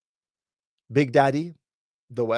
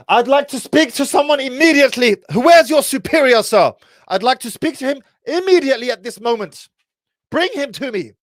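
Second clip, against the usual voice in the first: high, loud, indignant posh English demand; hammed-up posh Brit demanding the manager; posh English accent; 13 semitones higher, 9 dB louder than usual. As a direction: lay the queen's English on thick, outraged. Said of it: "Start speaking with my queeniest English".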